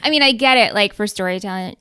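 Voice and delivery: ordinary delivery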